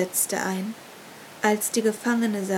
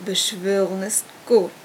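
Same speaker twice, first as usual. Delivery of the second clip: knowing grin; 4 dB louder than usual. A narrator's reading. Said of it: "grinste das Oberhaupt wissend".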